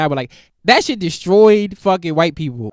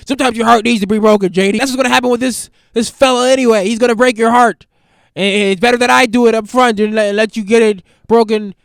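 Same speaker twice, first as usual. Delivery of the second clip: stern dad; 4 semitones higher, 7 dB louder than usual. Acted. Dad lectures daughter.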